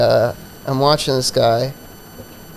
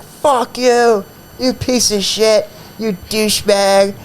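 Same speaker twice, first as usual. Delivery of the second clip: mock angry yell; 6 semitones higher, slower than usual. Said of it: "I just hear him yell at me".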